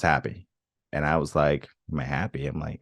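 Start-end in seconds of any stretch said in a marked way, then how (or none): 1.9-2.4 low, soft, dismissive echo of a question; offhand echo. Retold or imitated. Answering his brother's question at first, low and offhand. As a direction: toss the question back quietly, barely considering it.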